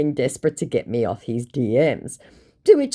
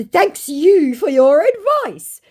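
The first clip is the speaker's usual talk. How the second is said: higher, slow put-on scammer voice; mock scammer voice; 13 semitones higher, 6 dB louder, slower than usual. Vlogger imitating the scammer's smug thanks. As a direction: drag out the thanks smugly. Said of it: "To which he said".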